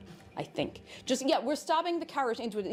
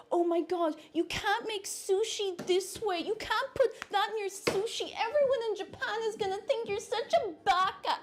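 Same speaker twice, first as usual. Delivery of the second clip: high, scandalised mock outrage; shrill mock horror at the sushi, pitched way up; mocking purist; 10 semitones higher than usual. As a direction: squeal like an offended sushi purist. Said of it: "you get a lot of people who are like".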